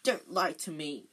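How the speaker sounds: ordinary delivery, nothing marked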